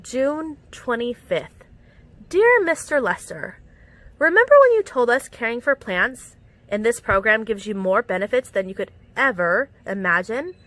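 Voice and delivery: usual speaking voice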